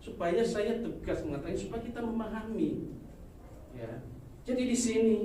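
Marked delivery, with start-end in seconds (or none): none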